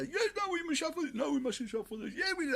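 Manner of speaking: high, loud flip-flopping voice; shrill media parody switching yes and no; mocking media. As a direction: squeak out the dithering. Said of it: "they change like the weather".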